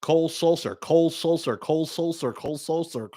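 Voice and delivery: quick-fire repeats of "Cole Sulser", run together; fast repetition. Shown as a demonstration. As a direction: rattle off the name like a tongue-twister. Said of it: "Say that ten times fast".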